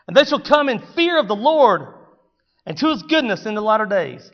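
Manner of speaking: loud, high scripture proclamation; preaching at full force. Real, not acted. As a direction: declaim the prophecy boldly.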